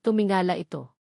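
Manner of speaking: usual speaking voice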